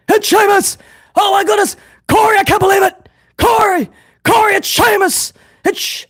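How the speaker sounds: high, frantic gasping shouts; Kevin Patrick's breathless calls. Retold or imitated. Mocking the commentator's Sheamus call to Corey, frantic high breathless yelling. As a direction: gasp out every call like a commentator losing it. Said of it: "he sounded like he was out of breath because he would gasp things".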